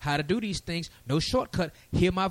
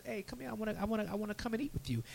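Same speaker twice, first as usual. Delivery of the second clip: much softer, higher, coaxing voice for the Messiah; soft invitation; 4 semitones higher, 12 dB quieter, faster than usual. Retold or imitated. Messiah inviting a believer to dine, soft and coaxing. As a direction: call them in gently, almost hushed.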